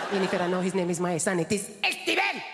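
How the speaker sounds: put-on insistent Spanish-accented mum, rising on "Steven". Mum scolding her daughter, indignant Spanish accent. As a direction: insist like an offended Spanish mother. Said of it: "never lost her accent".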